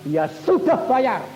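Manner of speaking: high, loud fervent exhortation; full-force preaching. Acted. Preacher urging the congregation how to pray, loud and high. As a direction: drive it home to the hall.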